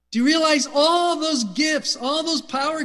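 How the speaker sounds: loud, high, fervent preaching; shouting the question to the room; preacher's shout. Real, not acted. Preacher shouting at the congregation about God's gifts. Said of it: "sorry I'm shouting at you".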